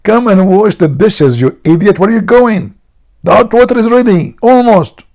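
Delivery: very high mock-shrewish yell; hammed-up scolding wife; screaming wife. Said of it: "His wife, she screamed".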